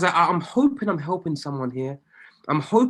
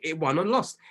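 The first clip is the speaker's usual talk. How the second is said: ordinary talk, nothing marked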